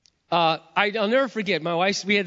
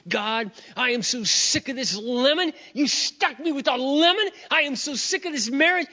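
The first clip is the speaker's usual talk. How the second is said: high, exasperated rant at God; angry outburst; 9 semitones higher than usual. Retold or imitated. Husband venting to God about his marriage, angry outburst. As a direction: act out a fed-up complaint, building higher. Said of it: "I just said this out loud".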